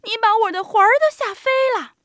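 high, loud, quick squeal of fright; old woman crying out. A narrator's reading. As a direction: shriek fast as a startled little old lady. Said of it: "瘦小的老妇人喊起来".

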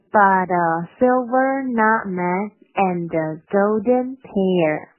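slow, word-by-word model reading, projected; deliberate, drawn-out teaching line; slow demo. Shown as a demonstration. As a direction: stretch out each word for learners. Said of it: "先快再慢".

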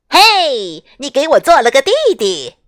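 fast, high, delighted call; happy shout. Acted. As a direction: call out gleefully to her. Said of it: "雪宝高兴地冲艾莎喊道".

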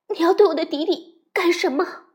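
frightened. Acted. Frightened mother questions wolf.

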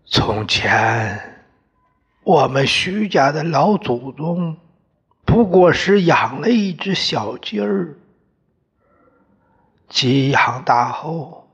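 a hoarse, reedy father telling the family history; hoarse old father. Acted. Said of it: "爹的声音嘶嘶的".

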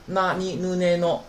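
那、你、ぬ、ね、の with clear n sounds, na ni nu ne no; na-row contrast. Shown as a demonstration. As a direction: model 那、你、ぬ、ね、の to set n apart from l. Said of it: "很好分的啊".

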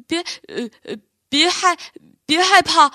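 shaky stammer swelling to a loud cry; trembling, stammering. A narrator's reading. Bear, shaking with fear, tries to calm the fawn, stammering and growing loud. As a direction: stutter in fear, then blurt it out. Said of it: "宝儿边说边抖".

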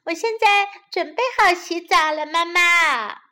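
high, loud little-bunny voice; eager child voice, sweet and high; sweetly smiling. A narrator's reading. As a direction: call to mummy sweetly, with a smile. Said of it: "他甜甜地笑着说".

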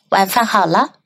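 ordinary talk

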